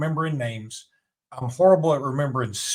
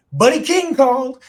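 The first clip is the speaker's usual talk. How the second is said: excited announcing; 12 semitones higher, 8 dB louder than usual. Retold or imitated. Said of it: "And I said".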